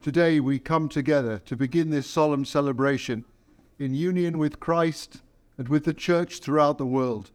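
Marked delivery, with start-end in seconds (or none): none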